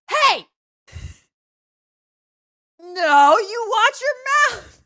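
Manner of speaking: imitating an offended yell; angry yell. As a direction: snap back as the offended Turbo. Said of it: "and Turbo goes".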